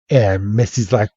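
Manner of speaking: ordinary talk, nothing marked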